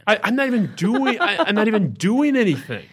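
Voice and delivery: exasperated protest. Real, not acted. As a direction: insist you did nothing, exasperated. Protesting innocence.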